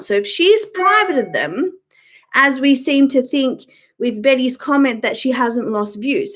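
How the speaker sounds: ordinary talk, nothing marked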